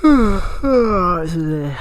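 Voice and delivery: slow, dragged-out weary complaint; drawn-out tired groan. Real, not acted. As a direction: stretch the first words out wearily. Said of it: "Sorry about that".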